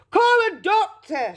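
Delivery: high-pitched, urgent call for help; shout. A narrator's reading. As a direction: shout as an alarmed father. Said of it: "shouted Mister Beauregard".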